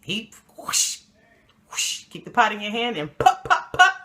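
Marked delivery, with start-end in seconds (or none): 0.6-2.0 hushed rushing whoosh noise; imitated whoosh. Shown as a demonstration. Mimes throwing hot grits. 3.2-3.9 high, loud popping noises; imitated popping hits. Shown as a demonstration. Mimes hitting with the pot.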